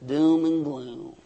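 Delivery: low, slow, glum mock voice; mocking gloomy church. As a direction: drag it down like a killjoy. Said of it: "But the church says".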